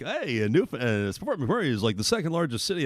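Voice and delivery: soft, offhand mock premier voice; a light impression of a cagey premier. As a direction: shrug it off like a politician playing cute. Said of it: "It's, uh, like".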